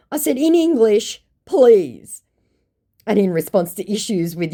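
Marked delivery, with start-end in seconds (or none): 0.4-2.2 sarcastic demand. Retold or imitated. Mocking reply to the scammer.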